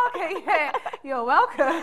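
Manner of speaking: laughing. Retold or imitated. Friends' reaction, laughing.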